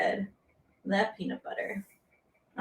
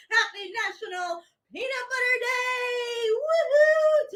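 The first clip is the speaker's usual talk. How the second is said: high, gleeful holiday cheer; squealing with delight; excited cheer; 11 semitones higher than usual. Real, not acted. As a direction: cheer it out to the class. Excited holiday greeting to class.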